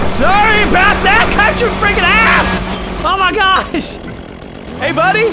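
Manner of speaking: loud, high, manic glee; over-the-top gleeful yells; gleeful shouting. Real, not acted. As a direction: yell it while hacking away happily. Gleeful chainsaw attack.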